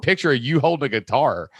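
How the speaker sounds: ordinary delivery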